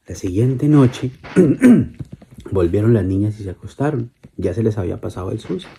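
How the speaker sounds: plain reading voice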